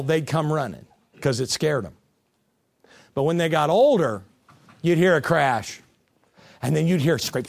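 ordinary talk

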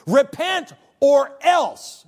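loud, slow, high-pitched ultimatum; thundering threat. Acted. Preacher voicing God's ultimatum to sinners, loud drawn-out threat. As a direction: draw out each word as a menacing warning.

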